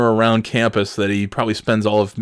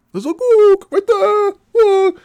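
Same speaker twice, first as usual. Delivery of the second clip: very high, loud imitation of the professor; mock yelling; 7 dB louder, slower than usual. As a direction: screech it out, drawn out. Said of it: "start yelling".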